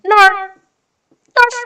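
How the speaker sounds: high cartoon exclamation; Pinky. Streamer turning a failed accent into a cartoon impression, high and silly. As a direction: squawk "Narf" high, like a cartoon catchphrase. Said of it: "reminds me of Pinky and the Brain".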